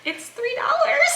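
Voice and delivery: high, slow, laughing answer; drawn-out giggly incredulity; amused disbelief. Retold or imitated. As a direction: squeal it through suppressed laughter. Her amused answer to panicking husband.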